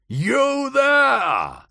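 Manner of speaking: shout. A narrator's reading. Howard calls to the watermen from his barge, loud shout. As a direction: shout to be heard from the river. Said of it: "Howard shouted at the watermen".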